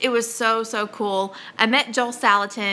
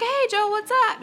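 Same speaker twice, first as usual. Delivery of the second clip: high, breezy mock of a buddy saying hi; casual friend greeting; 13 semitones higher than usual. Host imitating Amy greeting Joel, a high carefree hello. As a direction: toss it off like old pals. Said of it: "it was like".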